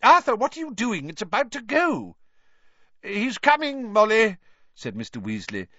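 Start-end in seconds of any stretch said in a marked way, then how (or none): 0.0-2.2 high, loud, scolding wife; high-pitched hurry-up cry; urgent calling. A narrator's reading. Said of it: "called Missus Weasley". 3.0-4.4 high, raised voice; loud hasty answer to a wife; calling back. Acted. Husband calls back to his wife.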